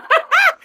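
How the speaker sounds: high burst of laughter; embarrassed laugh. Real, not acted. Caller admitting her story sounds crazy, laughing. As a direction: laugh out at your own story.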